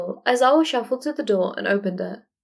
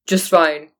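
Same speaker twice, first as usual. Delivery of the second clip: tight voice; 3 semitones lower, 4 dB louder than usual. Acted. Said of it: "voice tight".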